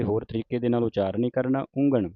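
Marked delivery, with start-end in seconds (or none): 1.8-2.1 ਉਂਗਣ as "ung-gaṇ", g sounded; audible g sound. Shown as a demonstration. Teacher models the gagga, ਉਂਗਣ with a clear g. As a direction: hit a clear g inside ਉਂਗਣ. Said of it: "ਘੱਗੇ ਦੀ ਆਵਾਜ਼ ਉਚਾਰਨ ਹੋਵੇ".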